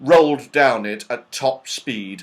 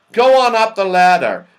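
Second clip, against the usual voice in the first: high, loud instruction; loud urging; 7 semitones higher, 11 dB louder than usual. A narrator's reading. Moonface sends the children up the ladder, urging loudly. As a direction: send them off with a raised voice.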